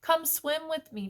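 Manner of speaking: high, cheerful frog call; calling out happily. A narrator's reading. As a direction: invite a friend along brightly. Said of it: "Frog called out happily".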